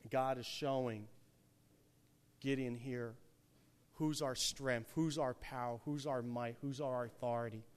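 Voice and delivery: ordinary delivery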